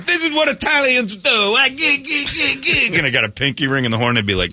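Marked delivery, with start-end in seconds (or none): 0.0-3.0 high, hammed-up Italian guy with a laugh. Host mocking Italians with the hand gesture, hammed-up wiseguy. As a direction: play up the wiseguy cliché, squealing. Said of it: "if I were to do that going".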